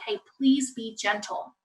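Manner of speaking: soft, slow coaxing; gentle request. Shown as a demonstration. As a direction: coax the child quietly. Models gentle request to child.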